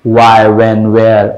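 why, when, where with a round-lipped W; rounded W sound. Shown as a demonstration. Teacher recapping W against V, rounded W in why, when, where. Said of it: "mouth को गोल हो जाना चाहिए".

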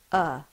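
a short, loud "uh"; schwa sound. Shown as a demonstration. Teacher showing pupils what the schwa says. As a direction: say the schwa plainly as "uh". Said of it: "which says".

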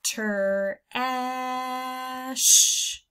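put-on "Ter, ah, sh" sounded out for children; segmented sounds. Shown as a demonstration. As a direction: split the word into three sounds. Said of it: "You do that with me".

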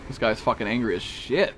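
usual speaking voice

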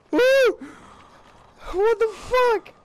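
very high, loud shriek; shrieking in shock; startled shriek. Real, not acted. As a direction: yelp in fright then yell.

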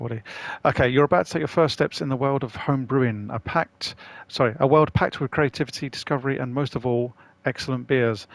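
ordinary talk, nothing marked

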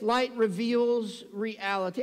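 "Light reveals reality" drawn out word by word; slow emphatic statement. Real, not acted. Preacher unveiling his last point, "Light reveals reality", slow and deliberate. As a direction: stretch "reality" slowly for emphasis.

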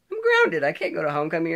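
retold high, loud protest; protesting son. Protesting to mom in story.

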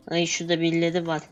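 ordinary delivery

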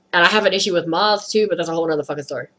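usual speaking voice

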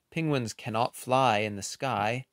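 ordinary delivery

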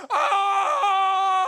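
loud high gasp of shock, fully let out; a stranger hearing her secret, shouting in shock; stunned shout. Retold or imitated. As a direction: cry out as if the word hit home. Stunned girls react to word.

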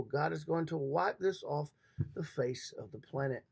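usual speaking voice

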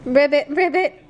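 high, bright little ribbit; frog croak. Shown as a demonstration. Mother shows her son how a frog sounds. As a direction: croak it out for a toddler. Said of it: "a frog say?".

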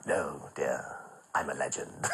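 low, softening theatrical grande dame; Danny La Rue. Retold or imitated. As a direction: purr it like an old stage star.

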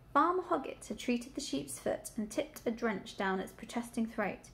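ordinary delivery, nothing marked

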